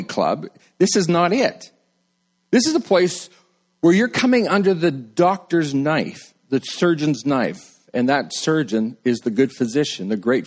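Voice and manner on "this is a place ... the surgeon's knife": usual speaking voice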